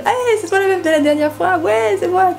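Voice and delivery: put-on high, squealing party-girl voice; bubbly club greeting. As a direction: squeal like meeting a club friend. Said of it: "en mode". (salut aigu et surexcité en boîte)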